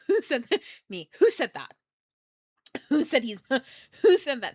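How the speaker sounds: loud, high demand broken by laughs; hammed-up startled challenge; mock alarm. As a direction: call out to an unseen heckler in your head. Acts startled by voices in head.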